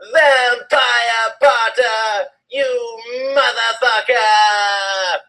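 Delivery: high, slow hammed-up yell; mock yell. A narrator's reading. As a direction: drag out the insult in fake fury. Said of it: "I yelled".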